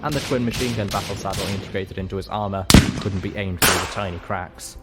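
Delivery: ordinary talk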